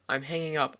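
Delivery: ordinary delivery, nothing marked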